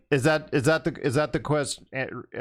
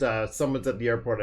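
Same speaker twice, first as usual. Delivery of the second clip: ordinary delivery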